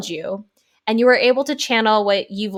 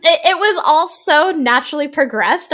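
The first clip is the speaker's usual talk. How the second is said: laughing; 6 semitones higher, 6 dB louder than usual. Real, not acted. Host laughing after being interrupted.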